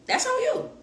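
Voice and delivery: high, sing-song repeat of "That's on you"; squeaky mocking echo. Real, not acted.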